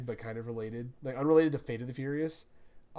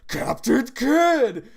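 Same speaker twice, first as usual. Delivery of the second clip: much higher and louder, very slow drawn-out cry; mock summon yell; 9 semitones higher, 12 dB louder, much slower than usual. Retelling George yelling the Persona name, slow and loud. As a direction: bellow the name like a battle cry. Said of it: "and he goes".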